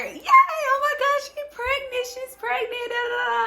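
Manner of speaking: very high squealed mock cheer; imitated excited screaming. Staff celebrating outside the door, imitated excited screams. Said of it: "folks screaming like".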